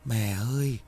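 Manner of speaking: put-on a ghost child calling gently; soft ghostly call. A narrator's reading. Ghost son calling his mother, soft eerie call. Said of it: "tiếng gọi khẽ".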